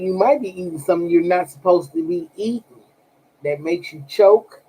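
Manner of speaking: ordinary delivery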